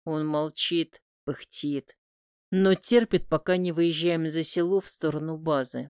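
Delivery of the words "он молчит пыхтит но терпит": ordinary delivery, nothing marked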